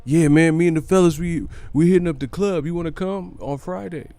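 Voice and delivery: a hint of a hyped buddy; friend asking him to the club.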